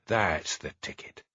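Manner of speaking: coaxing, slow and high, as to a wary animal; soothing a big cat into a cage, cooing high. A narrator's reading. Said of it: "cooed Hamish".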